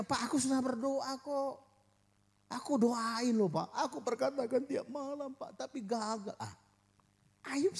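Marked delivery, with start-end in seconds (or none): 0.0-6.3 complaining prayer. Pretend. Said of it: "kita bisa bilang".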